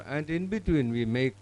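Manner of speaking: ordinary talk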